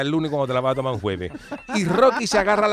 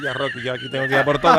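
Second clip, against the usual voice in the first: laughing, louder fired-up promise; mock pumped-up vow; 3 semitones lower, 3 dB louder than usual. Rocky telling himself to go all out, laughing pumped-up. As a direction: psych yourself up, half laughing.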